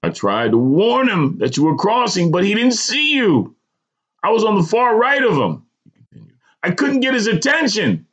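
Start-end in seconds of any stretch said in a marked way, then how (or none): 0.0-5.6 high-pitched, urgent excuse; almost apologetic. A narrator's reading. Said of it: "The stranger was almost apologetic". 6.6-8.0 high-pitched, urgent excuse; almost apologetic. A narrator's reading. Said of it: "The stranger was almost apologetic".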